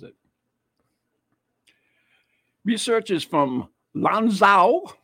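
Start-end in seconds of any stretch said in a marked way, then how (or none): 2.6-4.9 Chinese accent. Shown as a demonstration. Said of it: "Did I do that properly?".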